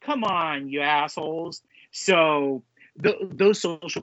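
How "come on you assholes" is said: exasperated reaction. Retold or imitated. Podcaster retelling seeing a spoiler post, exasperated. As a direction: replay an annoyed reaction.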